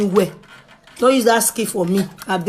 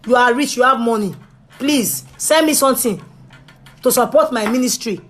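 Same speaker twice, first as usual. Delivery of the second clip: ordinary talk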